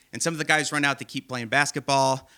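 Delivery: ordinary talk, nothing marked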